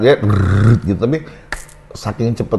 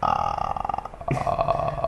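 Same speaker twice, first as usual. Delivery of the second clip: breathy loose ah; 9 dB quieter than usual. Shown as a demonstration. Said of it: "fase nempel tapi belum tight".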